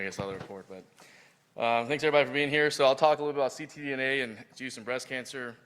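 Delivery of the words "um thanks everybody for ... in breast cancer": ordinary talk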